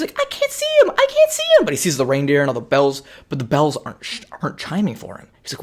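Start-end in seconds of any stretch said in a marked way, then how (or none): 0.2-1.6 put-on very high, whiny kid voice; high-pitched frantic kid; squeaky upset kid. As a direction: cry out like a desperate little boy. Kid panicking in retelling.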